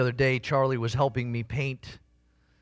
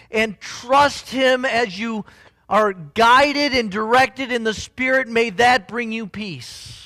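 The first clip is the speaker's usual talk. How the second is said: higher, louder, slowing into the build; full-force preaching; 7 semitones higher, 6 dB louder than usual. Acted. Pastor lifts his voice to the congregation, fervent preaching.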